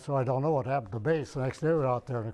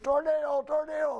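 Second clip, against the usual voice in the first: retold scream; 14 semitones higher than usual. Airman warning barracks mates of a twister, high retold scream. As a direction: re-enact screaming a warning, voice pitched way up. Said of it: "I opened the door and I screamed".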